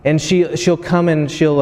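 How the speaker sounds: ordinary delivery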